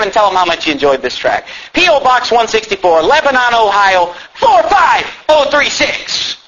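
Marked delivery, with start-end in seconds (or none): 1.7-6.4 high, slow, emphatic proclamation; naming names. Real, not acted. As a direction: call it out for everyone to hear. Calls out the address again.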